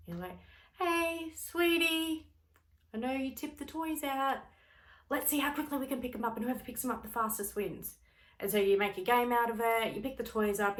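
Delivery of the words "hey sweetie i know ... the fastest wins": put-on high, bright playful voice to a child; higher, faster sing-song parent voice; sweet coaxing. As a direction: turn the mess into a game, gently. Calm parent coaxing toddler.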